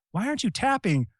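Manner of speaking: high, disbelieving questions; stunned he won't tap; incredulous. Retold or imitated. Me shocked my opponent won't tap out.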